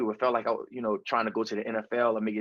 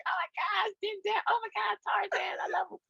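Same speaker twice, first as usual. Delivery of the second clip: very high, soft falsetto squeal; mock fangirl swoon in tiny falsetto; swooning female fan; 3 dB quieter than usual. Women gushing over a movie star, imitated high swooning. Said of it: "just make 'em like".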